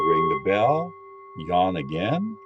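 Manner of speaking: calm, steady guiding voice for a listening exercise; guided meditation. Acted. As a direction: lead them gently into listening. Leads a meditation.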